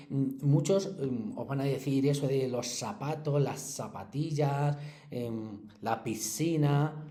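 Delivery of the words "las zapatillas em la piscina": "las zapatillas" slow, S instead of Z; seseo demo. Shown as a demonstration. Teacher imitating Latin American seseo to pupils, slow. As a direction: say "zapatillas" and "piscina" with plain S, slowly. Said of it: "Les cuesta decir la Z y dicen la S".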